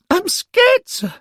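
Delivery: a lad owning up to his fear; scared schoolboy. A narrator's reading. Derek telling the angel he is scared, high and frightened.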